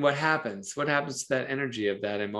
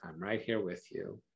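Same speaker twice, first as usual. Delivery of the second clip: soft, calm reassurance; reassuring; 4 semitones lower, 11 dB quieter than usual. Shown as a demonstration. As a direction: stay steady beside them. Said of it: "The riverbank is just right there".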